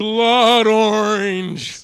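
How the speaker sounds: loud, long drawn-out playful shout; over-the-top echo of a name; mock bellow. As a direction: stretch it out like a hammy announcement. Playfully echoing co-host's drink name.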